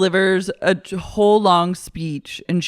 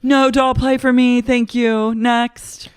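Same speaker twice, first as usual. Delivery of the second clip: higher, louder imitated brush-off; Lisa, dismissive; 7 semitones higher, 4 dB louder than usual. Housewife refusing the doll game, loud mock-dismissive voice. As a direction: dismiss it in a put-on high voice. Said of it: "Lisa goes".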